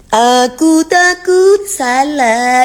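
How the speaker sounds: slow, high, whimpering fake sob; mock crying. Parodying a crying singer, slow high whimper. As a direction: parody a tearful plea, drawn out. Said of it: "sambil nangis-nangis gitu".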